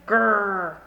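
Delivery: a loud little growl of protest; grumpy growl. Acted. Child growling at bedtime, grumpy growl.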